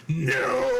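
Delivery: long drawn-out, emphatic no; comic denial. Real, not acted. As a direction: stretch the no out for laughs.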